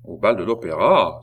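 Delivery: startled exclamation. A narrator's reading. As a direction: exclaim it in stern disbelief. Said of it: "s'écria le roi en fronçant le sourcil". (question exclamée, sourcils froncés)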